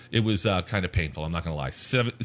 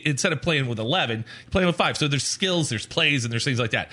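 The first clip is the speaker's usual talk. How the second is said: usual speaking voice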